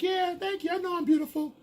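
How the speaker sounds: high falsetto, pleased with herself; flattered woman. Retold or imitated. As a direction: mimic a woman soaking up applause. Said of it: "She's walking off like".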